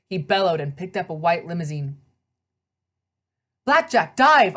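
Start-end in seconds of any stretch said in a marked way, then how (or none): 3.7-4.6 loud, urgent shout of a command; yelled order. Acted. The rider yells at his pegasus to dive, loud and urgent. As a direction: bark it at the horse to dive now. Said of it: "I yelled".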